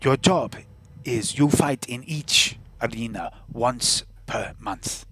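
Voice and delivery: put-on deliberate foreign-accented deal-maker; measured character voice with exaggerated foreign accent; accented arena boss. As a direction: lay out terms in a put-on accent. Game character lays out the deal.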